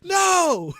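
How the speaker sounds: high, loud mock wail of dismay; anguished cry. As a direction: cry out in exaggerated despair. Acts out dismay at being killed.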